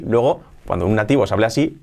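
normal talking voice

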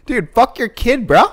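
hammed-up macho line; mock tough guy. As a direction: taunt the dad like a meathead.

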